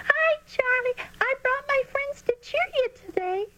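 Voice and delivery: put-on very high, squeaky little girl. A narrator's reading. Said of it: "a squeaky voice called out".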